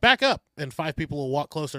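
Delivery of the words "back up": higher, louder "Back up"; firmer command. Retold or imitated. Officers ordering the advancing crowd back, louder firmer command. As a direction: raise it to a sharp warning. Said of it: "They're like".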